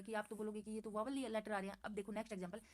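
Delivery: ordinary talk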